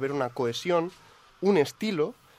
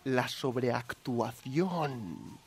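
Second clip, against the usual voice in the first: slow dramatic reveal; 4 semitones lower, 8 dB quieter, much slower than usual. Acted.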